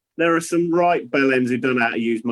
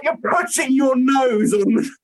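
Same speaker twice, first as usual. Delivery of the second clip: laughing disbelief; 8 semitones higher than usual. Retold or imitated. Wife sniffing candles through mask.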